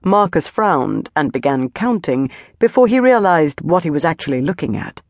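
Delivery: plain reading voice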